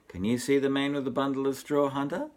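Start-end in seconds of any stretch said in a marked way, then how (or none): none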